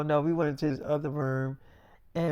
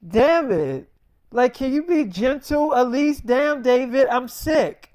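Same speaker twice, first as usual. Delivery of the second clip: high, loud whiny scolding of a husband; hammed-up fed-up protest; exasperated complaint; 7 semitones higher, 5 dB louder, slower than usual. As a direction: plead crossly, as the put-upon sick wife. Sick wife snapping at husband.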